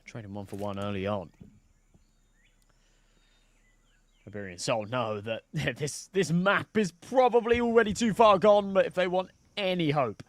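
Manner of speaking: steady live match call; play-by-play commentary. Acted. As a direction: call the action as it happens. Casting live esports round.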